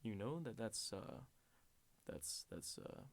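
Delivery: low, halting imitation with long pauses; hesitant deep-voiced clerk. Clerk awkwardly explaining the item, low halting voice. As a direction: sink low and stall before the awkward word.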